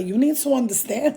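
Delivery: mock yourself in a Spanish accent; playful put-on Spanish accent. Shown as a demonstration. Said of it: "I'm doing a Spanish accent".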